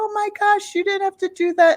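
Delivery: high, quick grateful protest; touched surprise. Retold or imitated. Mom receives cake pops, pleasantly surprised.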